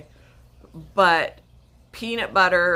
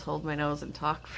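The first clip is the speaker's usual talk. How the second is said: ordinary delivery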